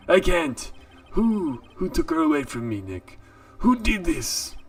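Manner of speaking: lightly voiced heartbroken buddy; distraught friend voice. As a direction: moan it like a dumped friend. Voices despairing friend Larry.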